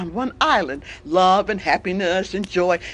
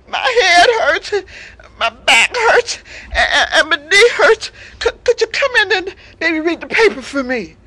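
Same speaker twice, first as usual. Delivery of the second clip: milk the pity like a fake invalid; feigned sick lion; 7 semitones higher, 5 dB louder than usual. Lion lists fake pains.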